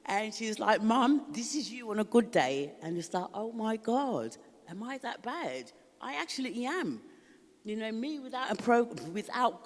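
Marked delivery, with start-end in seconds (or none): 0.8-2.7 higher, louder teasing daughter. Retold or imitated. 3.3-7.0 higher, slow, dawning shock; shocked realisation. Retold or imitated.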